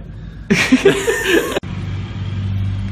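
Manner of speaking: high breathy burst of laughter; big laugh. Real, not acted. Storyteller cracks up at his own story, laughing hard. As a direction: laugh out loud at the punchline.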